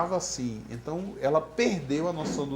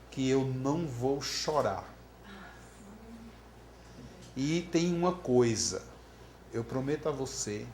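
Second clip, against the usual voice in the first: solemn vow; 2 semitones lower, 3 dB quieter than usual. Retold or imitated. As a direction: retell a grave graveside promise, unhurried. Filha promete ao pai no caixão. (promessa solene, pausada)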